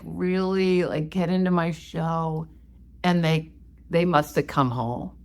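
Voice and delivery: normal talking voice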